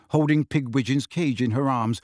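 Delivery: usual speaking voice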